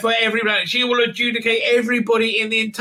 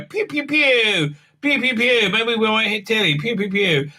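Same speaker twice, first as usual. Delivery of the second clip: mouth laser pews; 3 semitones higher than usual. Pretend. Playing along shooting at the alien with mouth noises. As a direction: fire off cartoon laser sounds.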